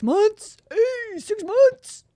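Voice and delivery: high, loud, drawn-out celebration; excited cheer. Real, not acted. Streamer cheering a subscriber's six-month milestone, high and excited.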